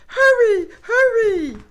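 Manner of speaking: high, loud urgent call; calling grandmother. Acted. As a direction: call him home in a panic. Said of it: "she called".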